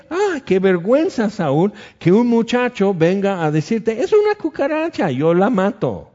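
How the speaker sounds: a playful taunt, lightly voiced; mocking David. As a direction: needle the king like a cheeky kid. David mocking Saul sarcastically.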